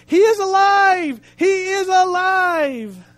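high, loud, drawn-out jubilant cry; rejoicing shout. Retold or imitated. Preacher acting the officer rejoicing at the resurrection, exultant shout. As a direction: shout it joyfully, stretching the last word.

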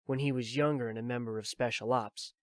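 ordinary delivery

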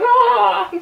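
high, loud mock joyful shout; imitating happy yell. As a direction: yell like a thrilled kid. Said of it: "He's like".

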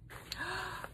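soft, sharp intake of breath; quiet gasp of delight; surprised gasp. Real, not acted. Sudden surprise at the gift.

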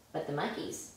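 ordinary delivery, nothing marked

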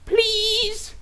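put-on high whiny kid begging. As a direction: beg like a little kid.

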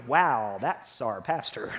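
deflated, as if he just let you down; disappointed. Pretend. Church member disappointed in their pastor, low and let down. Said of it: "there are times you're gonna look at me and go".

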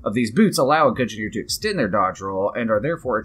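low, soft read-aloud voice; reading item text. Real, not acted. Player reading out a boots description, low read-aloud.

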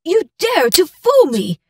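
indignant, shaken outcry; furious accusation. Acted. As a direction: lash out at the son who tricked her. Mother discovers she was tricked.

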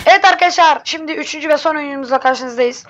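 loud energetic host opener; youtuber intro. Acted. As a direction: greet viewers like a host.

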